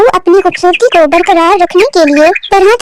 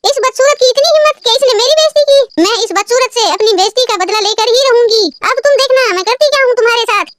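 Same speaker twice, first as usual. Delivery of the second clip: higher, sneering revenge vow; spiteful vain bird; 6 semitones higher than usual. Acted. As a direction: threaten her with venom. Rani vows revenge. (बदला लेने की ठानती घमंडी चिड़िया)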